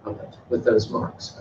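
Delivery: ordinary talk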